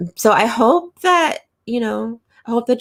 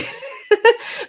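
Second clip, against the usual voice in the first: high laugh. Real, not acted. Laughs at own remark.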